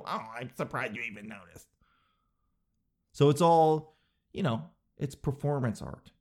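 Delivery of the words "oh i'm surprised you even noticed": hushed, put-on humble aside; much softer, feigning surprise; mock-modest parent. Parent replying to admiring strangers, hushed false modesty. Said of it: "And they go".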